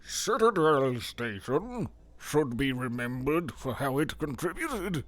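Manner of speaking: lightly voiced slow psychologist; Jeffrey Hammer voice. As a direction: read it like a stuffy scientist. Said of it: "Let me get my Jeffrey Hammer".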